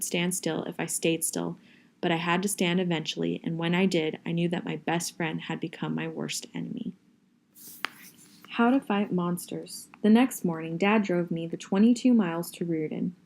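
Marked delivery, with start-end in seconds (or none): none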